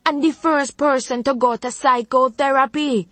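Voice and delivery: high, final-sounding Jamaican rebuke; stern Jamaican grandmother. Acted. Grandmother shutting down the therapy argument, stern Jamaican rebuke. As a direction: slam the door on the discussion.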